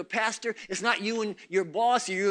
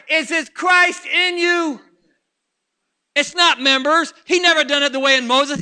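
higher, louder, hammering each line; full-force preaching; 8 semitones higher, 13 dB louder than usual. Real, not acted. Preacher exhorts the congregation, full-force preaching. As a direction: preach it hard to the back row.

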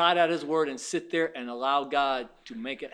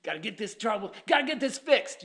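anxious fretting; 6 semitones higher than usual. Retold or imitated. Mimicking anxious believers.

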